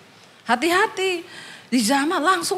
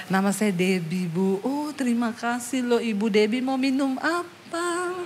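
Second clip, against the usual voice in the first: soft sweet; 4 semitones lower, 6 dB quieter than usual. Retold or imitated. Sweet polite exchange.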